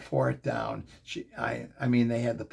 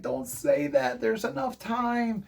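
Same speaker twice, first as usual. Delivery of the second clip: urgent protest; 7 semitones higher than usual. Retold or imitated. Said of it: "I'm like".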